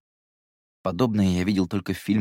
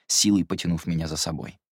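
usual speaking voice

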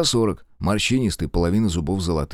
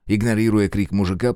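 ordinary delivery

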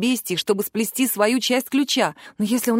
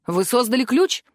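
ordinary delivery

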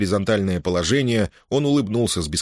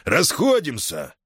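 high, slow barked announcement; announcing; 8 semitones higher, slower than usual. A narrator's reading. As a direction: call the visit over. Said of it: "объявил комендант".

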